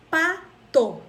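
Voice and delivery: "pato" as pa, pause, to; split into syllables. Shown as a demonstration. Teacher showing two mouth openings, "pato" split in two. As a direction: say "pato" in two separate beats. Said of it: "eu abri minha boca duas vezes".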